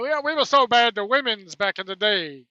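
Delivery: mushy mumble in a put-on voice; Tony Atlas mumbling impression. Host mocks Atlas's mumbled talk about women in a put-on voice. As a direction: talk like marbles in the mouth. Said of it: "talks like he's got marbles in it".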